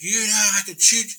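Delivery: high, loud imitated yelling; mock furious outburst; retold threat. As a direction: shout it as the angry local starting up. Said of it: "he starts like".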